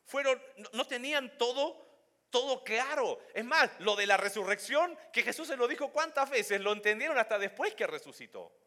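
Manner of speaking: fervent pastor's emphasis; preaching at full force. Real, not acted. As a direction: drive the point home to the congregation. Preaching builds.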